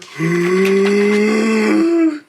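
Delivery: loud rising pre-sneeze gasp; big breathy intake before a sneeze; a hammed-up gasp before the sneeze; sneeze build-up gasp. Bear about to sneeze.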